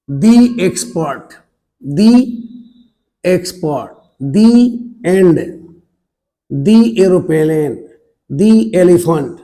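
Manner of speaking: THE pronounced 'dee' before vowel sounds, drawn out slowly; 'the' said as 'dee'. Shown as a demonstration. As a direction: model THE as a long, clear 'dee'. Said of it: "दी पढ़ते हैं, द नहीं।". (THE को 'दी' बोलकर धीरे से)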